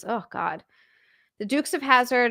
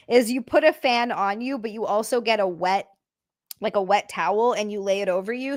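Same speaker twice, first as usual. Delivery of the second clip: ordinary talk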